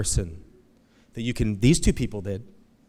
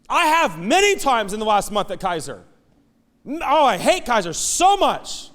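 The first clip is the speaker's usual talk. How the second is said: high, loud preacher in full flow; a preacher pouring out his Kaiser frustration; impassioned preaching; 11 semitones higher, 7 dB louder than usual. Acted. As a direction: drive the point home from the pulpit.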